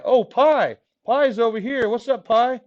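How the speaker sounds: usual speaking voice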